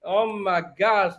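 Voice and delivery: normal talking voice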